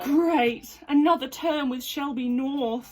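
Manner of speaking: loud, slow and sarcastic; sarcastic groan. Acted. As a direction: drag it out, fed up with her. Script, annoyed girl.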